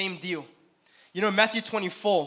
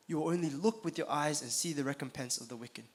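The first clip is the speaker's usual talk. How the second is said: quieter, reflective repeat of the verse; softer repeat; 4 semitones lower, 9 dB quieter than usual. Shown as a demonstration. Preacher repeating the verse for the congregation, softly. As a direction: say it again softly, letting it sink in.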